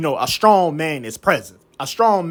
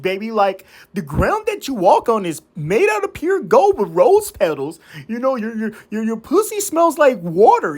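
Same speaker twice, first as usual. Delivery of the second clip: mock simp; 5 semitones higher than usual. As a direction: gush like a lovesick pushover, hammed up. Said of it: "small peon ass".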